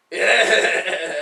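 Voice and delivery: loud imitated laughing 'yeah'; Alex's laugh. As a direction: mimic a friend's little laugh through the word. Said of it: "like, his little Alex laugh".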